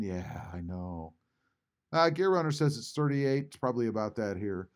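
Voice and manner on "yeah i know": slow, low, quiet mumble; half-hearted imitation, low and slow; mumbled low quiet. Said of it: "Justin's laughing or crying or saying".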